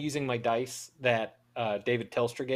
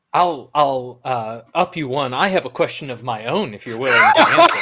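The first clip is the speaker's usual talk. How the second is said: in-character challenge; 8 dB louder than usual. Acted. Character teasing the others back with a riddle, gleeful and loud.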